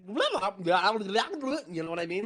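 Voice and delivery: put-on slow, drawn-out made-up accent; Atlantean accent. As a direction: stretch it like a strange foreign tongue. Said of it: "the headmaster would say".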